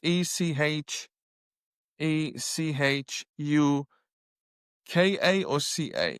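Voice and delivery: slow letter-by-letter spelling of Echuca; spelling out slowly. Shown as a demonstration. Traveller spelling out the town name Echuca, slowly. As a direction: spell each letter out carefully. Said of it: "if that's how it's pronounced".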